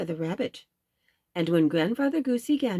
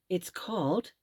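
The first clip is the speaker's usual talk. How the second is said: ordinary delivery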